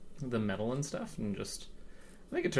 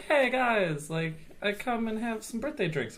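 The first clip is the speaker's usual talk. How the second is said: higher-pitched imitated party invite; eager invitation; 11 semitones higher, slower than usual. Birthday host inviting friends for drinks, eager and high-pitched.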